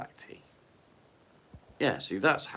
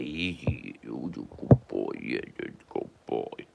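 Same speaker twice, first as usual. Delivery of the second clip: low dog praise; 9 semitones lower than usual. Real, not acted. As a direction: drop low and croon to the dog.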